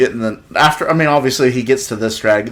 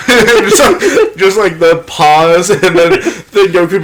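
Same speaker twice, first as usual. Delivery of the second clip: talking through laughter; laughing; 7 semitones higher, 5 dB louder, slower than usual. Real, not acted. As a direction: laugh while speaking. Laughing while retelling.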